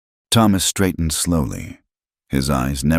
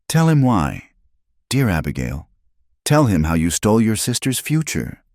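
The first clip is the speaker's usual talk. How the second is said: goading; 6 semitones higher than usual. A narrator's reading. Miriam goading Abigail.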